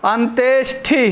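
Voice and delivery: ordinary talk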